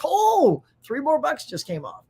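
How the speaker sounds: high, delighted oh at the coupon; pleasantly surprised. Retold or imitated. Reacting to a coupon at checkout, high and delighted. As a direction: react like you just saved money. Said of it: "I'm like".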